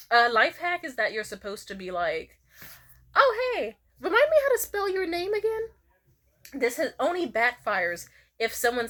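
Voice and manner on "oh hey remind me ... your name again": high, put-on polite voice; fake-casual ask. Fishing for a forgotten name, high and innocent. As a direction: ask sweetly, pitched way up. Acts out the name life hack.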